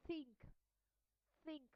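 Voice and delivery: "think" opening on a voiceless TH; unvoiced TH. Shown as a demonstration. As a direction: model "think" slowly word by word, TH as pure air. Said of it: "will be pronounced as unvoiced sound".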